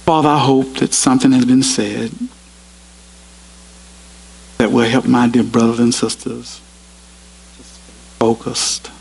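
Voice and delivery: measured, earnest prayer voice; closing prayer. Acted. Preacher prays aloud for his brothers and sisters, earnest. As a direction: lead the congregation in prayer.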